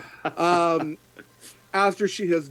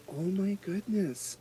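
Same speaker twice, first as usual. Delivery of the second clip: awed gasp; 5 semitones higher, 7 dB quieter, slower than usual. Acted. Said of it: "and she goes".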